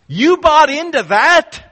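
scornful jab. Retold or imitated. Pharisees mocking officers who believed Jesus, loud scornful jab. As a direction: fling it at them in disbelief. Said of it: "it's an emphatic you".

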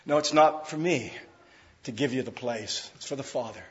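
soft, hushed, gentle correction, no rebuke; gentle reply. Retold or imitated. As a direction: answer the proud boys kindly and quietly. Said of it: "Christ doesn't respond that way".

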